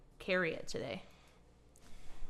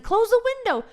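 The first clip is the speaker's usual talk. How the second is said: much higher and louder; high squeaky voice; 13 semitones higher, 7 dB louder, faster than usual. Retold or imitated. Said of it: "I said".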